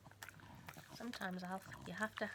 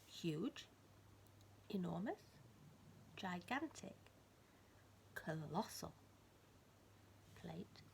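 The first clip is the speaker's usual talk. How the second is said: softer, each word rising gently; soft wondering list; 3 semitones lower, 9 dB quieter, slower than usual. A narrator's reading. Storyteller to child, softly wondering at the plate. Same words repeated softly.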